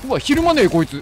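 real panicked yell; alarmed shout. Real, not acted. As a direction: shout as it won't flinch. Monster keeps coming under fire.

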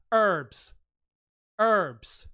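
loud American "herbs" with the h dropped; mock American accent. Host teasing how Americans say herbs, American accent. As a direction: say "herbs" as "erbs", American style. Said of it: "If you're American".

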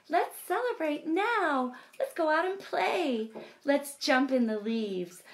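joyful cry. Acted. Bear thanking friends and wanting to play, excited and high. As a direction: cheer like you're finally well. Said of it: "Bear cries".

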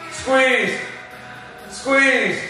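usual speaking voice

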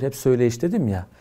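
ordinary talk

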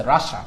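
রাশা repeated as ra-sha; English-style রাশা, ra-sha; Russia as two syllables. Shown as a demonstration. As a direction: drill রাশা with no "i" sound. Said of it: "উচ্চারণটা রাশা".

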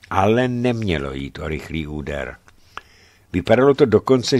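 plain reading voice

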